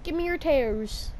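normal talking voice

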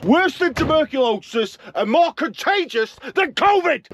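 theatrical rant about grease; hammed-up outrage. Real, not acted. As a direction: overplay the exaggeration. Hyperbolic rant.

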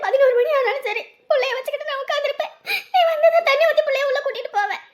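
choked-up pleading, half laughing; tearful. Acted. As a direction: sister begs her brother to come, crying and laughing. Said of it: "சரி, சரி, அழாத".

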